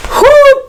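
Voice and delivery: high, loud whoo; whoo exclamation. Real, not acted. Reviewer reacting to the song, high whoo.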